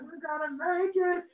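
put-on a kid sure they'll escape; hopeful cry. Kid to brother mid-chase, hopeful and high.

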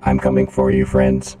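low, loud robot filter; menacing game robot voice. Acted. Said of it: "you don't sound like Pathfinder".

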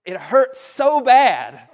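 loud, drawn-out complaint of pain; acted pain. Preacher re-enacting a stubbed toe, loud mock agony. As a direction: stretch it out loudly in mock agony.

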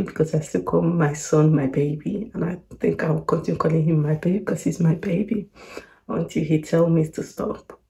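normal talking voice

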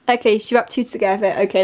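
ordinary talk, nothing marked

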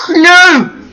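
sudden high, loud "no"; high yelp of alarm; panicked shout. Real, not acted. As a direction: yell as danger springs at you. Creature explodes beside him in game.

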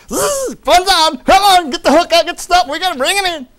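high, loud excited hollering; excited angler. Acted. Preacher acting out reeling people in, excited high shouting. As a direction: holler as if landing a big catch.

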